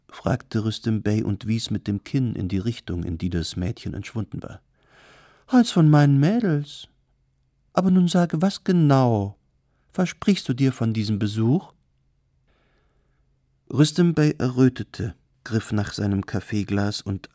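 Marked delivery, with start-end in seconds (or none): 5.5-11.7 high, loud, showy hostess voice; theatrical hostess, coaxing. Acted. Kardelin to her client about his visit, theatrical and coaxing. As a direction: play a grand procuress pitching to a client.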